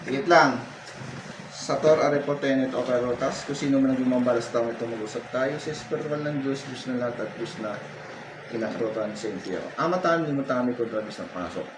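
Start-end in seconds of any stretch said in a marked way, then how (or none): 1.6-11.7 low, soft ritual prayer; hushed, droning healer's chant; murmured incantation. Real, not acted. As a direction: murmur it like a secret spell, very low.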